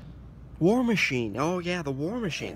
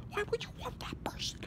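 whispering; 9 dB quieter than usual. Acted. Whispered aside.

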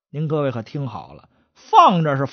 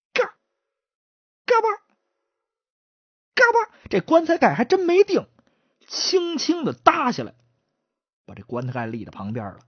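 ordinary delivery

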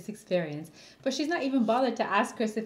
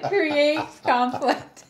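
slow mocking echo; 4 semitones higher, 7 dB louder, much slower than usual. Mocking her phrase.

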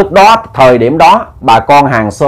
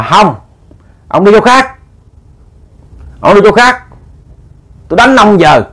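put-on much higher, heated drunken threat; angry yelling; 7 semitones higher than usual. Drunk son yelling at ghost father to leave, heated. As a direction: yell him away, raising the voice.

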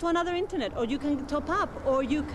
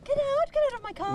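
sharp raised order to leave; shouting at trespasser; 11 semitones higher than usual. Acted. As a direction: yell at him to get out. Woman orders man from car.

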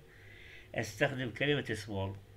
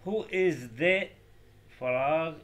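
who is the, very slow and louder; slow dictation; 5 semitones higher, 6 dB louder, much slower than usual. Shown as a demonstration. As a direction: read the sentence for students to write down. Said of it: "مثال". (إملاء بطيء وواضح)

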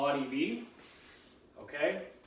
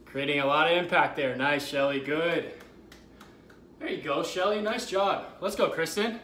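excited cheering; 5 dB louder than usual. Real, not acted. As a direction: fire up the class by name. Cheering on class by name.

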